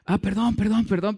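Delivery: mock the big man blubbering his sorry; tearful apology. Preacher imitating the crying prisoner apologizing for the hug. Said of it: "pero el tipo llorando".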